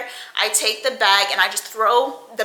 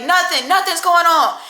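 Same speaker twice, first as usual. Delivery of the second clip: higher, louder quick denial; mock innocent answer; 8 semitones higher, 5 dB louder than usual. Girlfriend covering to family, higher denial. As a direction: deny it brightly, high. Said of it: "She's like".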